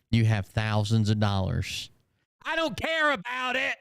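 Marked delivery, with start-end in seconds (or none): 2.4-3.8 high whiny falsetto parody; mocking mimicry. Heckler parroting the streamer's denial about money, mocking falsetto. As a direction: throw the denial back in a squeaky mock voice.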